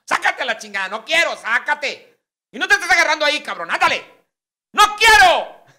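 furious wife. Acted. Wife in the middle of housework screams at her husband. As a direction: scold him like an outraged housewife.

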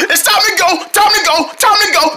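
high urgent yelling; panicked shout. Acted. Deer calling friends to flee, high panicked shout. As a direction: yell at everyone to run. Fleeing dinosaur.

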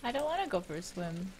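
ordinary delivery

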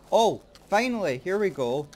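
relieved burst, loud and high; finally, a real shout of relief; excited. Real, not acted. Finds treasure clue after long search.

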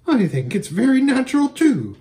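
timid agreement from the mare; gentle mare. Acted. Hwin agreeing with Bree, high and timid. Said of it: "said the mayor".

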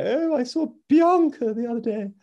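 high-pitched, hammed-up posh English accent; very posh British. Shown as a demonstration. Mocking how a posh person says Bianca, very posh British. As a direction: put on an exaggerated upper-class British voice. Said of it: "people would think you're very posh".

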